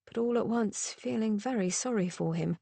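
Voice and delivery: plain reading voice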